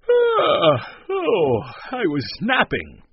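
big high yawn into the words. A narrator's reading. Man at his door claiming a nap, yawning. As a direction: yawn loudly as you speak. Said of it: "He yawned".

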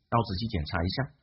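ordinary talk

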